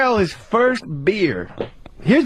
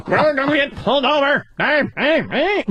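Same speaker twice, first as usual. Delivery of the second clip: Dave Chappelle; 7 semitones higher than usual. Acted.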